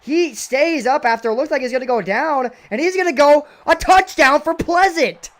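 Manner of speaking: usual speaking voice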